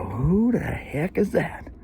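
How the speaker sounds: slow, high, hushed amazement; stretched-out wondering question; awestruck. Retold or imitated. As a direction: re-enact being stunned at first sight. Retells first sight of striking girl.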